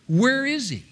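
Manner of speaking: high-pitched, mock bewildered question; puzzled searching. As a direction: ask it high, imitating baffled disciples. Disciples searching for Jesus.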